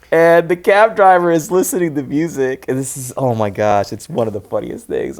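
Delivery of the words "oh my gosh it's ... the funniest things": normal talking voice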